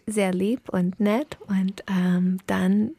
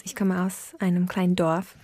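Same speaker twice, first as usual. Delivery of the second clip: usual speaking voice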